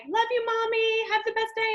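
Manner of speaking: put-on high sing-song child voice; lightly voiced sweet daughter; sweet little daughter. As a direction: chirp it like a happy little girl. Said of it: "My daughter, like".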